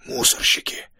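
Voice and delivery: sibilant, voiceless whisper; whistling whisper. A narrator's reading. Steve warns the hidden group, in a whistling whisper. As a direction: whisper it with a hissing edge. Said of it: "свистящим шёпотом произнёс".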